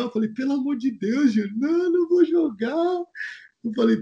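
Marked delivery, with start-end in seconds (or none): 0.3-3.0 high, crying plea, much higher; imitating tearful refusal. Júnior refusing to play, tearful high plea. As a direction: whine it like a scared player begging off.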